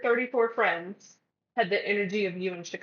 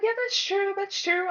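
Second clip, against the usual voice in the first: much higher, put-on sweet voice; grudging agreement; 11 semitones higher than usual. Friend grudgingly agreeing with the move, sugary high. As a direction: concede it while clearly not meaning it.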